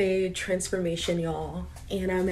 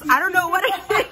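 much higher through laughter; laughing; 10 semitones higher, 4 dB louder than usual. Real, not acted. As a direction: giggle out the words. Laughing about soda.

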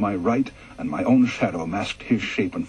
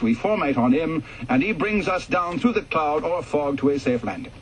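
higher, formal instructor voice; officer lecturing the pilots on emergency procedure, formal and raised; briefing officer; 6 semitones higher than usual. A narrator's reading. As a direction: read it out like an RAF briefing officer addressing pilots.

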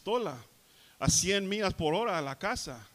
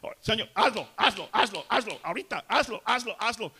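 loud, high, insistent repeated commands; mock arrogant prayer; 6 semitones higher, 7 dB louder than usual. Preacher mimics people ordering Jesus like a servant, arrogantly. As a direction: order Jesus around, exaggerated and demanding. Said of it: "prayers that order Jesus around like he's our little servant".

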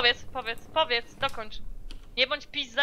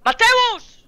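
shouted name; 11 semitones higher, 14 dB louder than usual. Real, not acted. Calling streamer by name loudly.

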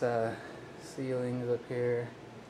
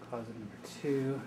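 out of breath; 6 dB quieter than usual. Real, not acted. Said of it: "Sorry, I'm out of breath".

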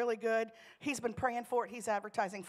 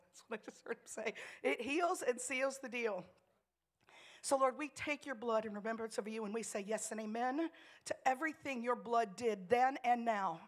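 prayer. Acted. Leads communion prayer.

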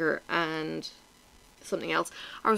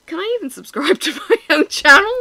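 loud, high, laughing through the question; laughing disbelief; 10 semitones higher, 11 dB louder than usual. Retold or imitated. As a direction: ask it cracking up at the absurdity. Mock outrage at own recommendation.

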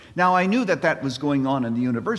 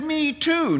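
high, excited "Me too."; eager me too; 3 dB louder than usual. Retold or imitated. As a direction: pop it up high, delighted. Joke, speaker's eager reply.

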